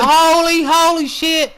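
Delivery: loud, high burst of amazement; excited exclamation. Real, not acted. Reacting to a dive over the top rope, loud and amazed. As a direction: blurt it out, stunned. Big move over ropes.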